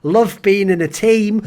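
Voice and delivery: high, slow put-on chumminess; mock reassurance. England placating its occupied neighbours, mock reassurance. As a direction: placate them with drawn-out, insincere niceness. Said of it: "you had to be like".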